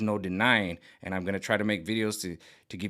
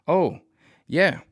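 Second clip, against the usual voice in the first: higher, louder excited oh yeah; 8 semitones higher, 8 dB louder than usual. Real, not acted. Reacting to the next card, a loud excited oh yeah. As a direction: burst out eagerly.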